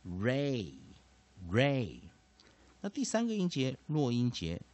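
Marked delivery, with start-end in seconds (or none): 0.1-2.0 ray, ray with a slow, drawn-out long A; long A demo. Shown as a demonstration. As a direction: stretch the A in ray. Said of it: "所以把A拉成长母音".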